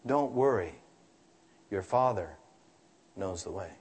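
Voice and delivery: remembered mother's voice, dying away softly; soft echo of mother. Retold or imitated. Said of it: "the echo of my mother's voice".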